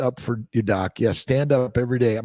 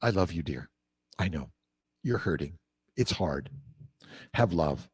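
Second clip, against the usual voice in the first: gentle and hushed, soothing a hurting spouse; tender, soft reassurance; 9 semitones lower, 8 dB quieter than usual. Shown as a demonstration. Spouse comforted softly after a dumb remark. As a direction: say it softly, like comforting a loved one.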